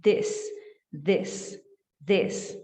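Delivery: "this" said like "dés"; this with open e. Shown as a demonstration. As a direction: voice "this" with a short open e. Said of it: "Isso aqui não é this, é". ("this" dito como "dés")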